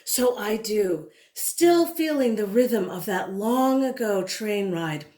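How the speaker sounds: usual speaking voice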